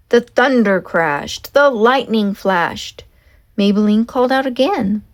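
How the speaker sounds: usual speaking voice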